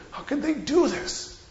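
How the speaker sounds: disbelief. Real, not acted.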